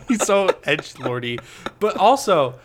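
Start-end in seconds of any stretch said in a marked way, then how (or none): none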